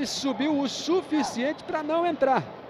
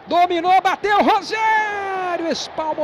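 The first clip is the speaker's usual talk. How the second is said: sudden shouted play call; excited shout; 7 semitones higher, 7 dB louder than usual. Real, not acted. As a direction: shout it as the shot goes. Near-miss shot commentary. (narração empolgada)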